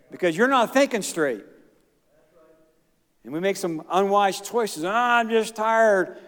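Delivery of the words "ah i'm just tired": drawn-out, higher-pitched mock sigh of tiredness; mock weary excuse. Churchgoer excusing unwise choices, mock weary complaint. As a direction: imitate a worn-out complainer, dragging it out.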